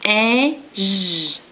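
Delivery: each sound said apart; segmented sounds. Shown as a demonstration. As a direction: sound out slowly, one by one. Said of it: "je vais te dire les sons d'un mot".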